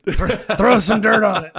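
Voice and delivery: laughing, loud and high mock bark; mock tough-guy advice. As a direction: toss it off like a drill sergeant, laughing.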